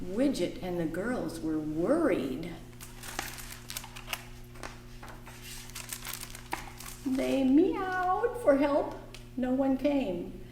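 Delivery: lively picture book reading; reading to children. A narrator's reading. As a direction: read it warmly to the kids.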